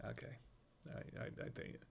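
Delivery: barely voiced aside; whispering. Real, not acted. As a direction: drop to a whisper. Very quiet aside.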